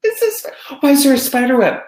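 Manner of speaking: very high, playful teacher voice; high mock surprise. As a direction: act amazed for the kids, way up high. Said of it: "I'm like".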